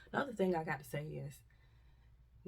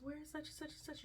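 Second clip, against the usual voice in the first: high, soft panicked voice of a stranger; high soft stranger calling for family; 10 semitones higher, 10 dB quieter than usual. Retold or imitated. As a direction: mimic a frantic bystander searching. Imitating panicked crowd.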